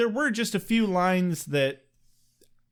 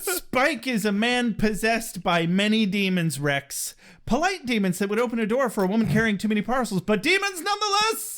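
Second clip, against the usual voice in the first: higher, hammed-up oration jumping high at the end; mock-dramatic monologue; 4 semitones higher than usual. Podcaster declaiming about Spike to a co-host, hammed-up drama. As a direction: declaim it, then soar on the last phrase.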